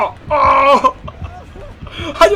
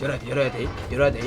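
muttering; 13 semitones lower, 9 dB quieter, much faster than usual. Real, not acted. Urgent low muttering.

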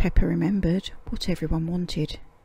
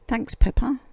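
higher, louder happy thanks; cheerful thanks; 5 semitones higher, 5 dB louder than usual. Acted. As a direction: thank her gladly. Dogs thank Peppa.

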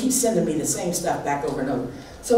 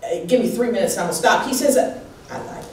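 higher, louder, fervent sermon voice; full-force preaching; 8 semitones higher, 3 dB louder than usual. Acted. Pastor preaching to her church at full force. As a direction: preach it to the rafters, building.

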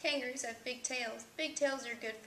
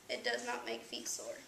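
usual speaking voice

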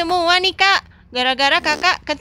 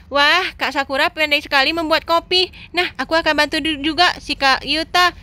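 ordinary delivery

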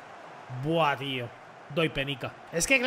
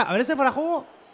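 ordinary talk, nothing marked

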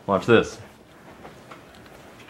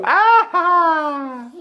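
playful shout. Real, not acted. Scaring baby playfully.

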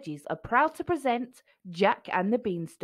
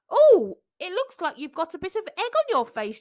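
chuckling; 9 semitones higher, 6 dB louder than usual. A narrator's reading. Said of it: "chuckled the golden hen".

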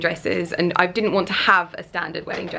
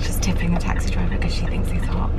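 conspiratorial whispered aside; 2 semitones lower than usual. Real, not acted. Vlogger teasing friend about the cute taxi driver, whispering. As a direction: hush it so she can't hear.

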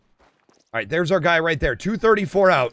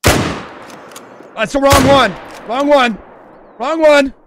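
loud, raised, urgent dismay; dismayed cry; 4 semitones higher, 5 dB louder, much slower than usual. Real, not acted. Hunter hit the wrong one, dismayed. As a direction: cry out at a mistake.

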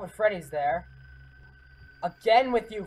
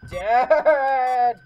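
despairing wail; 6 semitones higher, 11 dB louder than usual. Real, not acted. Despair outburst.

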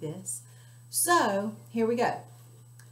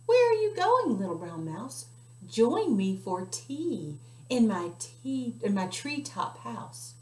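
hooting owl; 3 semitones higher, 4 dB louder than usual. Acted. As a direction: swoop in with a hoot. Owl speaks to mouse.

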